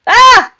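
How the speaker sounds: short, loud, high-pitched yelp; startled shriek. Real, not acted. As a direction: shriek as the fall catches you. Falls in the game.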